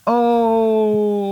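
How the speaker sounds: high, drawn-out crowd ooh; crowd reaction. Acted. Whole crowd reacts to the insult, long high ooh. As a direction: hold it like a jeering arena. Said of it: "The whole crowd goes".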